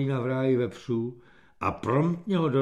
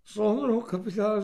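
high, soft, nervous voice; small frightened voice; timid, skittish; 7 semitones higher, 3 dB quieter than usual. A narrator's reading. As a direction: report sheepishly to your superior. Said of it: "řekl plašivě".

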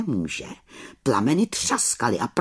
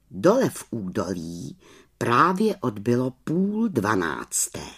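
ordinary delivery

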